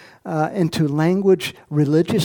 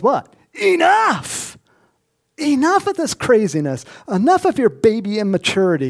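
much higher, loud, scolding; furious rebuke; 6 semitones higher, 4 dB louder than usual. Retold or imitated. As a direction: Samuel snaps at Saul. Samuel rebuking Saul.

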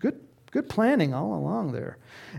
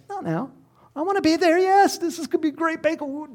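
eager but delaying; 10 semitones higher, faster than usual. Retold or imitated. People delaying the banquet.

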